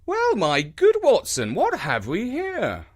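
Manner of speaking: lightly voiced, lively detective; brisk, curious greeting; a hint of an energetic Holmes. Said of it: "an energetic voice saying".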